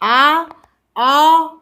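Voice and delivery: long loud आ, held open; drawn-out aa demo. Shown as a demonstration. Teacher stretching आ to show the sound. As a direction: stretch out आ loudly for the class. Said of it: "आ नहीं है ये".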